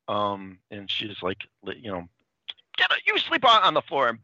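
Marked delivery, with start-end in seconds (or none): 2.8-4.1 high, loud retold outburst; mock angry yell. Podcaster as Francine kicking drunk Freddie to the floor, mock yell. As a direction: order him out of bed, hammed-up. Acting out girlfriend kicking boyfriend out.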